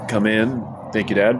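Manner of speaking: usual speaking voice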